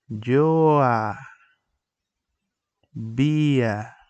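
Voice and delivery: emphatic syllable demo; loud slow demo. Shown as a demonstration. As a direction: stress "Yo" and stretch "había". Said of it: "Vamos a eliminar nuestra letra H".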